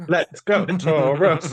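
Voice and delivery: high, loud cheer; playful cheer. Real, not acted. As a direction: shout it like a cheer. Joking cheer for patron.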